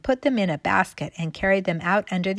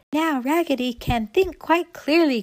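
high, loud cheering echo; excited cry; 8 semitones higher, 5 dB louder than usual. A narrator's reading. All the dolls echoing Raggedy, high excited cry. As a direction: cheer the news in a raised voice. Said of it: "cried all the dolls".

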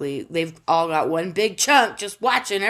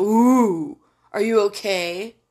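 mock concern; 4 dB louder, slower than usual. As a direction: coo with exaggerated fake concern. Mock concern for war criminal.

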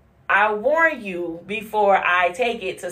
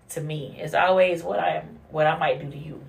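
ordinary delivery

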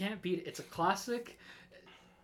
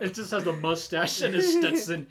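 louder, animated riff; excited laughing description; 4 semitones higher, 11 dB louder than usual. Pretend. Cohost describing the cowboy cow, louder animated riff. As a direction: build the silly image with glee.

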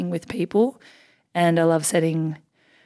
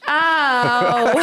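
mock cry of pain, pitched up; pained yelp; 6 semitones higher, 4 dB louder than usual. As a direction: yelp as if the stitches sting, half laughing. Retelling lip being stitched.

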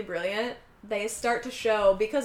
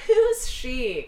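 louder, higher mock-breezy question; fake lighthearted; 4 semitones higher, 7 dB louder than usual. Woman asks about her rival, fake lighthearted. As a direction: feign lightness while clearly annoyed. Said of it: "kind of saying it in a, a fake lighthearted way".

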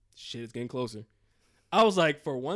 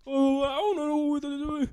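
panicked; 11 semitones higher, 10 dB louder than usual. Retold or imitated. Reliving panic, high and loud.

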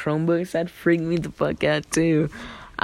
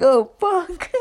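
high, laughing "oh, fuck"; a startled laugh-curse at the jump scare; 13 semitones higher, 4 dB louder than usual. Retold or imitated. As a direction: blurt it out in a laughing fright. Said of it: "and I was like".

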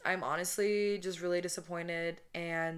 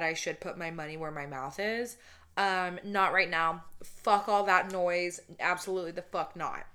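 ordinary delivery

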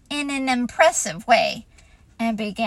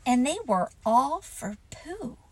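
ordinary delivery

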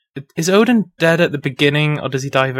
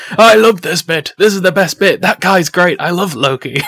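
higher, gushing fan of himself; hammed-up delight; 6 semitones higher, 3 dB louder than usual. Loki as Odin watching his own play, hammed-up delight. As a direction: gush theatrically, really ham it up. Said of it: "really hamming it up".

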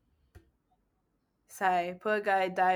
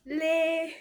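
Singlish; 10 semitones higher than usual. Retold or imitated. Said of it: "the intonation just sounds weird".

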